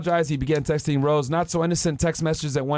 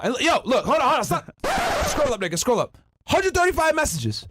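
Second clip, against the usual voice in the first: high, laughing disbelief at the count; excited disbelief; 9 semitones higher than usual. Real, not acted. Host shocked by the hundred thirty-five messages, laughing high. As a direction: yelp at how many messages there are.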